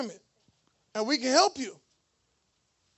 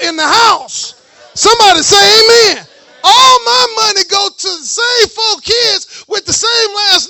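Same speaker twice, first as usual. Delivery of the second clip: full-force preaching; 4 semitones higher, 11 dB louder than usual. Acted. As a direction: preach it out at the top of your voice to the church.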